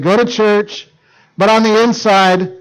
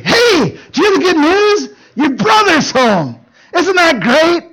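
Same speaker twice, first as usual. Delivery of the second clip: much higher, bright news shouted out; excited servant; 10 semitones higher, slower than usual. Acted. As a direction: burst in with the good news. Servant excitedly announcing brother's return.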